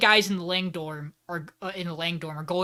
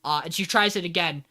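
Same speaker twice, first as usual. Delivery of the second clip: ordinary delivery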